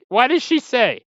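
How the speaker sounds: ordinary talk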